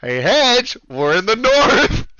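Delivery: loud, high, drawn-out announcement; gleeful taunting shout. Pretend. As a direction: crow it loudly at a friend. Gleefully announcing the Canada joke.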